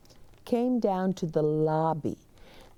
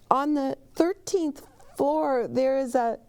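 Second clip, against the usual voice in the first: high, urgent warning; high anxious bellhop; 9 semitones higher than usual. Acted. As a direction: rush to warn him in a high voice.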